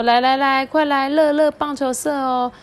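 upbeat club recruiter shouting; senior recruiting. Acted. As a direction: call out to new kids like a cheerful senior. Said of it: "学长就说".

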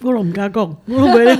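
ordinary talk, nothing marked

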